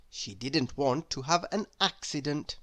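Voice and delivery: usual speaking voice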